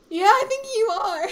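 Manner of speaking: loud, high imitation of a friend's answer; high-pitched mock reply. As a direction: pitch it way up and push it out. Friend's reply acted out, squeaky.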